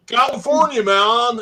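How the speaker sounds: high, loud laid-back surfer drawl. Retold or imitated. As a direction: say it like a stoked surfer dude. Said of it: "he talked like a total surfer".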